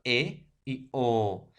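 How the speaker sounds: E and O said very slowly, mouth half open; half-open vowel demo. Shown as a demonstration. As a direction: draw out E and O for the class.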